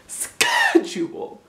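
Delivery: schedule very high and slow; squeaky schedule. Shown as a demonstration. Coaching viewers to use their throat. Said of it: "just use your throat".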